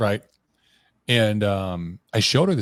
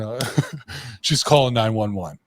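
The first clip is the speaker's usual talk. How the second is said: normal talking voice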